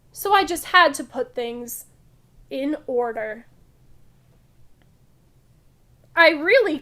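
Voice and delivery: loud, lively picture-book read-aloud; reading to children. Acted. Teacher reading a funny museum story to kids, loud lively read-aloud. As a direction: read it big and bright for the class.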